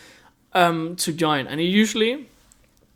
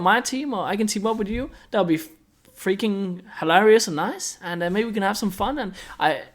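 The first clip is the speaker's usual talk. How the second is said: higher, light and eager newcomer voice; friendly humble player; 4 semitones higher than usual. Retold or imitated. Showing viewers how a humble player offers help, higher friendly voice. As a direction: sound keen to help everyone. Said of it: "Just be like".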